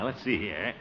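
ordinary talk, nothing marked

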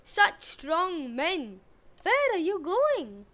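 slow, high, mock-admiring girl voice; butter up the brutes; flattering maiden. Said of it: "she said".

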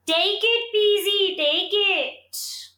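high, airy tree spirit call; rustling tree voice. A narrator's reading. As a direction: let it rustle like leaves. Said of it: "rustled the people".

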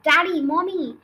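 loud, high call to parents; bursting out. A narrator's reading. Matilda arriving in a dash, bursting out loudly. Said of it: "Matilda burst out, gasping for breath".

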